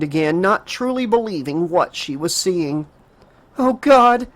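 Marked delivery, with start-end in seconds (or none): 3.6-4.3 high, loud horrified gasp; horrified cry. Acted. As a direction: cry out in shock. She sees Steve tied up.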